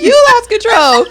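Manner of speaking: high, loud accusation; hammed-up scolding cry; mock scolding mother. Repeats mother's scolding for laughs.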